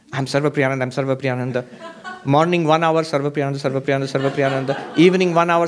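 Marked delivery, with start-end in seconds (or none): 0.1-1.6 low monotone rote recitation; mock self-reminder. Actor reminding himself who he is, in a mock drone. 3.0-5.0 low, monotone mock chant; mock self-reminder. Hour-long morning drill to not forget himself, mock droning.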